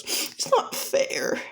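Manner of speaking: slow, high, breaking-up wail; hammed-up tearful whine; mock crying. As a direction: whimper it slowly in a tiny high voice. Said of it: "As she starts crying and goes".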